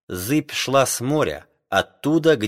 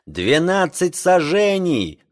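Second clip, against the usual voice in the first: sailor's shout; 6 semitones higher, slower than usual. A narrator's reading. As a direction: call the depth out. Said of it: "крикнул Спирка".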